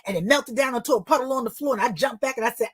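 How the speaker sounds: ordinary talk, nothing marked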